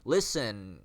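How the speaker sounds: brash mock busker calling out to the park; mock street performer. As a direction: parody a pushy busker begging for attention. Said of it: "I'm not like".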